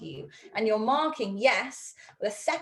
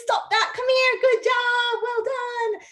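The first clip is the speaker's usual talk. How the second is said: high, loud, gushing praise to a dog; excited dog praise; 6 dB louder, much slower than usual. Acted. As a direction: call a puppy in with big delight.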